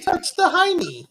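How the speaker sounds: loud, slow, very high squeal; hammed-up high-pitched goofy cry; silly high voice. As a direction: squeal it out in a mock voice. Joking chant in silly voice.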